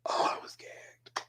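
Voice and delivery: soft, voiceless, awestruck repeat; overcome whisper. Real, not acted. Reviewer stunned by the performance, overcome whisper. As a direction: whisper it as if the breath is gone.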